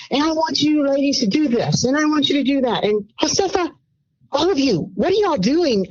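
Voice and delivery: high, bossy head chef voice, ordering the cooks; imitating a bossy head chef up high. Head chef Tyra barking orders at the cooks, high bossy voice. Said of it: "Tyra's like".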